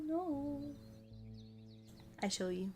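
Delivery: ordinary delivery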